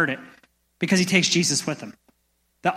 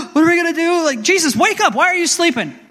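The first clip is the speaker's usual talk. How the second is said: high, loud panicked cry for help; panicked disciples; 10 semitones higher, 5 dB louder than usual. Retold or imitated. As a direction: shout in panic over the storm. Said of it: "his disciples are freaking out".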